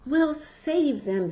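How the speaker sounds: put-on high, loud, slow brave kid; heroic boy. Peter vowing to save the Martians, bold and high. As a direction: promise it boldly. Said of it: "said Peter".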